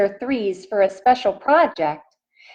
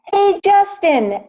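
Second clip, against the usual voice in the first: high, louder call across the yard; 11 semitones higher, 5 dB louder than usual. Acted. Emilio calling Justin from the tetherball court, loud and high. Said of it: "Emilio calls from the tetherball court".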